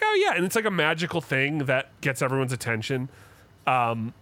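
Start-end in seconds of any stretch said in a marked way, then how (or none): 0.0-0.3 squeaky mock "oh yeah"; high mock voice. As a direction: pop way up into a silly voice. Mocking quote, sudden high.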